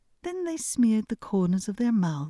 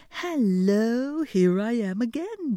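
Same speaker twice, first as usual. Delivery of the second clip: louder, higher hail; shouting; 5 semitones higher, 4 dB louder, slower than usual. A narrator's reading. Hans shouts after his brothers, loud hail. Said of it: "shouted Clumsy Hans".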